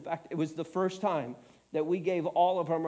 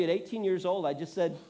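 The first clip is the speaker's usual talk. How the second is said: ordinary delivery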